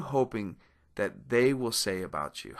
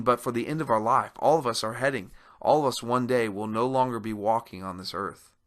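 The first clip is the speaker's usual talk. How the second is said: normal talking voice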